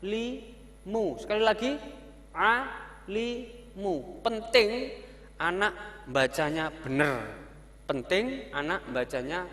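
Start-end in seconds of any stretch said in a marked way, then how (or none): 0.0-4.3 alimu read slowly in separate syllables, higher; broken reading. Shown as a demonstration. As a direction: break alimu into pieces. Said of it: "membaca putus-putus aja".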